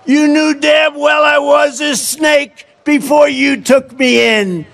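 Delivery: slow, sneering, pushed out; hammed-up gloating snake; grinning reptile. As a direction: gloat at the dying woman. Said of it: "said the reptile with a grin".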